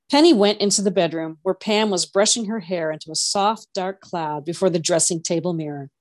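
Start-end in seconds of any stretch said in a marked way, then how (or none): none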